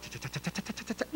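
soft clicking cha cha cha; flipping letters noise. Retold or imitated. Preacher at PO box, soft clicking letter-flip sound. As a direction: mimic leafing through letters fast.

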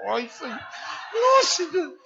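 high squealing plea with a laugh; high laughing mock whine; mocking pleading believer. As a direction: ham up the giving believer's plea.

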